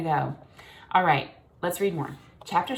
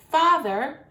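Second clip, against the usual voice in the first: high, louder call; young swan calling; 10 semitones higher, 7 dB louder than usual. A narrator's reading. Young cygnet calling his father, high and loud.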